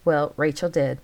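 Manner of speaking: ordinary delivery, nothing marked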